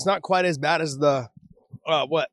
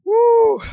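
loud high woo from the burn; pained woo; 10 dB louder than usual. Real, not acted. Burning mouth from hot chip.

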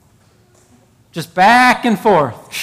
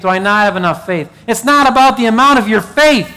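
raised, fervent preaching to the congregation; 4 semitones higher than usual. Acted. Pastor urging the congregation, fervent preaching. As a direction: preach it out, rising and insistent. Preacher building to full force.

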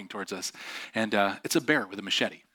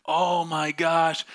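slow, louder amazed exclamation; stranded man's relief; 5 semitones higher, 3 dB louder, much slower than usual. Retold or imitated. Stuck driver amazed his car is out, slow loud relief. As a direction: draw out the relief. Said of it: "he's like".

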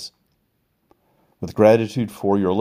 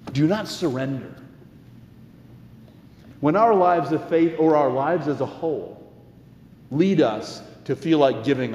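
earnest, rising preacher's cadence; sermon delivery; 6 semitones higher than usual. Acted. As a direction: preach it to the congregation.

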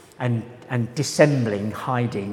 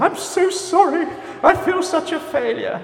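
hammed-up apology in a very high put-on voice; over-apologetic person; 3 dB louder than usual. Course attendee confessing failure, hammed-up high-pitched apology. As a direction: squeal the apology in mock despair, far above your own pitch. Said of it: "you get people who are saying".

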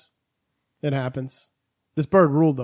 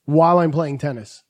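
normal talking voice

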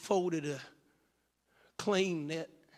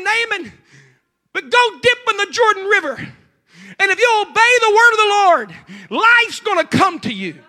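high, pleading, near-crying appeal; impassioned plea; 13 semitones higher, 12 dB louder than usual. Acted. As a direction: plead with him, voice breaking. Addressing Naaman directly.

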